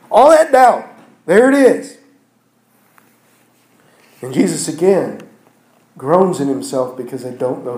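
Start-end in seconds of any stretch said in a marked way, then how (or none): 0.1-2.0 much higher and louder; pointing out doubt, emphatic; emphatic exclamation. Real, not acted. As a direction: ring it out. Preacher exclaims over the doubt.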